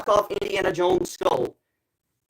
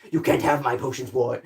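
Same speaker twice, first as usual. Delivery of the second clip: put-on a touch lower, gruff old shopkeeper; potion seller; 3 semitones lower than usual. Potion seller turning away the knight, mildly lower. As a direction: refuse the knight like a stingy merchant. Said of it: "if only Blue knows what I'm referencing".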